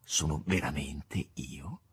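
hushed, slow, whispered question. A narrator's reading. Master whispering to the stranger, hushed. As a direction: breathe it out barely voiced.

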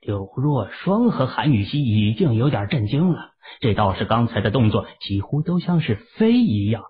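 ordinary delivery

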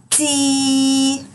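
تي with the ee held long and high; long sung-out تي for kids; long drawn vowel. Shown as a demonstration. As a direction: stretch the tee sound out. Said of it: "صوت طويل ويبقى".

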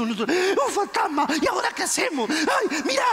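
high, panicky mock cries; hammed-up high-pitched alarm; voices of fear. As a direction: act out the frightened disciples. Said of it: "dieron voces de miedo".

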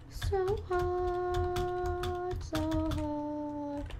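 high, drawn-out whine; whiny. Pretend. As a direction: whine it like a sulky kid. Whiny repeat of "so hard.

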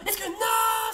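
high, loud cry as the shot goes wide; excited shout. Real, not acted. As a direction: gasp then yell at the near miss. Near miss on goal.

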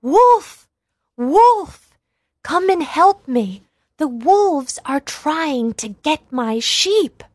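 high, loud cry of Wolf; shouting for help. Acted. Shepherd boy shouting to the villagers, loud and high. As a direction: yell it out with all your might. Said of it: "crying out with all his might".

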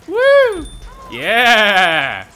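loud, high whoop of joy; cheering whoop. Real, not acted. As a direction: celebrate like you just scored. Celebrating good progress.

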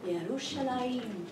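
Yerushalayim savored slowly and softly, ending in -ah-yeem; Hebrew pronunciation. Shown as a demonstration. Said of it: "you just say it like that".

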